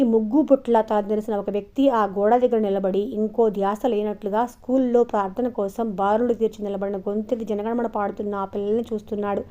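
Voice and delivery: usual speaking voice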